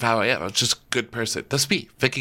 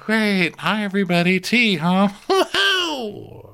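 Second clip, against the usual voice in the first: much higher, forced cheerful greeting ending in a whoop; fake enthusiasm; 10 semitones higher, slower than usual. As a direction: fake delight at a boring party. Cast fakes enthusiasm.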